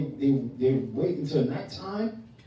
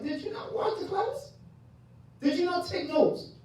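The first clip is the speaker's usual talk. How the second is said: very high, soft mock questioning voice; incredulous questioner; 4 dB quieter than usual. Teacher imitates someone questioning an unprepared student, high mock voice. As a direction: put on a tiny high-pitched voice to chide. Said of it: "and it's like".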